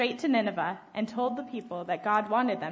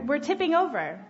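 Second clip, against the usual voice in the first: higher-pitched mock alarm; cried out; 5 semitones higher, 4 dB louder than usual. A narrator's reading. Second sailor warns the crew in a storm, crying out. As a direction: call it out, lightly panicked, pitch lifted. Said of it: "cried another".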